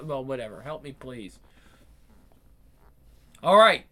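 ordinary talk, nothing marked

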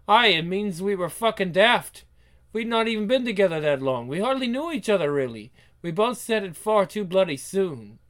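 acted Scottish exasperation; exasperated Scotsman. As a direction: argue it was all too hasty, Scottish-style. Reads Scottish character's angry reply.

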